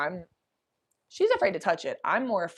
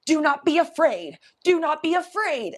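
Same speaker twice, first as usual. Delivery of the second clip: brave command; 9 semitones higher, 4 dB louder than usual. Retold or imitated. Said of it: "she goes".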